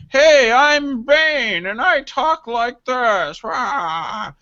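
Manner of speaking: mock a bad radio caller doing the masked villain; drawn-out singsong with a growl at the end; Bane voice. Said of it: "Call in and do your best Bane impression".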